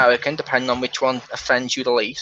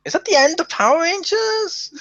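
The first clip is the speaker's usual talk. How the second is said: put-on high, worried kid question; panicked young fan; childlike alarm. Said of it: "and I'm like".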